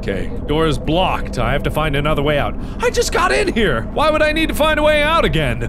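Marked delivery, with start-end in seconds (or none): 2.8-5.6 high-pitched, exasperated protest. Real, not acted. Player complaining about the blocked door, exasperated. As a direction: protest in disbelief, pitch jumping up.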